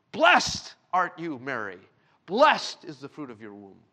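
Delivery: slow, loud, joyful proclamation. Acted. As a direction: cry it out beside yourself with joy. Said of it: "beside herself with a loud voice".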